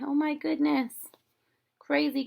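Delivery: ordinary delivery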